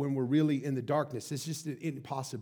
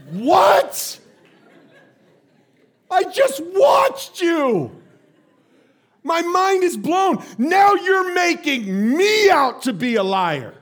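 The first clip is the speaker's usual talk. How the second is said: slow, high incredulous shouting; outraged dad; 14 semitones higher, 14 dB louder, much slower than usual. Retold or imitated. Dad accused by lying daughter, outraged shout. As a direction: yell it, stretching the words in disbelief.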